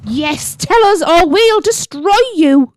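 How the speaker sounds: high, slow, drawn-out threat; threatening villainess. Acted. Bellatrix threatens to destroy the riders, drawn-out villainous menace.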